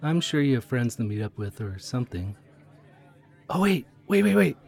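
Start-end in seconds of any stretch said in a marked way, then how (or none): 3.5-4.6 urgently flag someone down; excited. Real, not acted. Conductor spotting the furry who owes her a rig, excited. Spots the furry she met.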